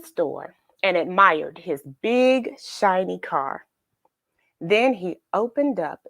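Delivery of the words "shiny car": usual speaking voice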